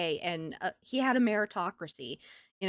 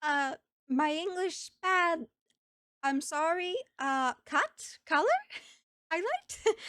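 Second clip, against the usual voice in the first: high, slow halting broken English, struggling for words; a hesitant customer at the salon, trying hard in broken English; broken English client; 7 semitones higher, slower than usual. Retold or imitated. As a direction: mimic a nervous client fumbling for English words. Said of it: "They would try so hard".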